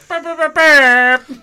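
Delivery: loud, high, slow honking "burp"; air horn imitation. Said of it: "the other sound effect that goes".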